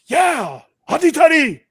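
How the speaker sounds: loud, high mock German cheer; fake German accent. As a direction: ham up a German fan shouting. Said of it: "that was supposed to be German".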